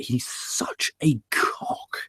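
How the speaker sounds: slow, whispery put-down; hushed insult. Real, not acted. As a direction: drop to a near-whisper and draw it out. Insult landed as slow aside.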